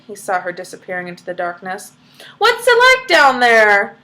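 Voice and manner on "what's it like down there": louder, slow call down a hole. Acted. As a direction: call down into the dark. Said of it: "he called out".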